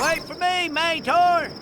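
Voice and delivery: high call after a friend left behind; high pleading call, wait up. Acted. Calling after his buddy.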